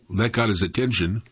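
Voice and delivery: ordinary delivery, nothing marked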